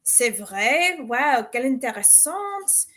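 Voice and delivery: charming French lines. A narrator's reading. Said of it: "he chimed with a winning smile".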